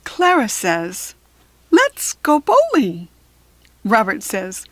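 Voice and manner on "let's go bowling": higher, louder eager pitch; excited kid suggestion. Acted. Clara suggesting bowling to the class, eager and bright. Child voice in read story.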